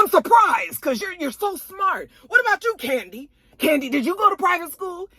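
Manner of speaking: high, sugary fake-polite question; mock shady sweetness. Marlo digs at Kenya's schooling in a shady high voice. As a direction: needle her with fake sweetness. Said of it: "trying to be shady and mean".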